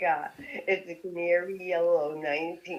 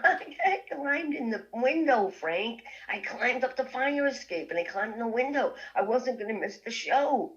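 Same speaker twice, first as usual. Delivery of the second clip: put-on higher, eager teenage voice; lightly raised girlish plea; young girl pleading; 7 semitones higher than usual. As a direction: play your giddy younger self. Her young self, voiced high.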